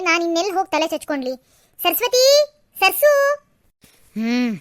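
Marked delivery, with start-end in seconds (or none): 1.8-2.2 higher, carrying call of a name. Acted. Calling the girl, no answer. 2.8-3.1 louder call. Acted. Calling the girl again louder.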